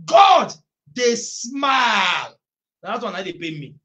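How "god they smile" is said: high, loud, very slow shouted scripture; full-force preaching. Acted. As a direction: thunder the verse over the congregation.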